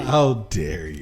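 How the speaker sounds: mock outrage echo. Real, not acted. Friend echoes the outrage back in mock offense. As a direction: repeat it with fake indignation.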